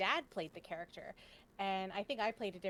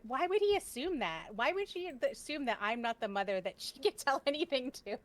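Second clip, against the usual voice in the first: higher and louder, offended; mom getting mad; 5 semitones higher, 5 dB louder than usual. Retold or imitated. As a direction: play the hurt, indignant mother. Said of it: "my mom actually got really mad".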